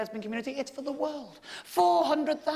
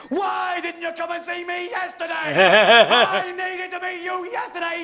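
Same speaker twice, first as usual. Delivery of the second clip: hammed-up indignant shout, louder; mock anger; 6 semitones higher, 9 dB louder, slower than usual. Venting at the stranger for missing his birthday, mock shout. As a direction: yell it like a wronged man. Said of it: "I could not conceal my anger".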